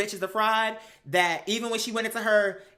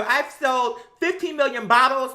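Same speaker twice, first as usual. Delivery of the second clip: higher, slow boastful mock; mock bragging; 4 semitones higher, 4 dB louder, slower than usual. Mocking Kim's bottle claim, slow boast. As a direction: puff it up, bragging. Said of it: "or no".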